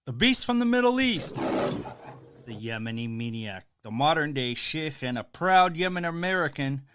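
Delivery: loud ring-announcer hype, punching each title; booming self-introduction as the beast from the Middle East; hype announcer intro. Acted. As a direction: pump the crowd like a wrestling promo. Wrestler-style show intro.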